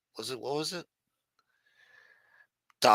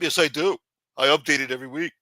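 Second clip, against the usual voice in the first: slightly raised nosy questioning; lightly voiced Casperosa; 3 semitones higher, 3 dB louder, faster than usual. Casperosa grilling the councilman, lightly raised voice. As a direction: press him for answers, a bit higher. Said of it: "Casperosa's gonna be popping out".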